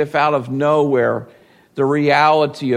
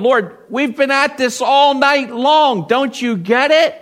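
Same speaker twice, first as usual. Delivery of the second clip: higher, exasperated complaint; exasperated groan; 10 semitones higher, 4 dB louder than usual. Retold or imitated. Peter complaining to Jesus, exasperated and higher. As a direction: whine it like a fed-up fisherman. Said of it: "He groaned".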